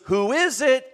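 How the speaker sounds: high call through a closed door. Retold or imitated. Brother calls to visitor at the door, calling out. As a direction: call out to whoever is knocking.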